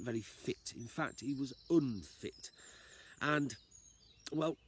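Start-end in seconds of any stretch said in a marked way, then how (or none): none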